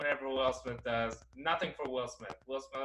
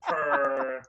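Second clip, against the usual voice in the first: laughing through the words; words broken up by laughter; 10 dB louder, much slower than usual. Real, not acted. Laughing while explaining formula.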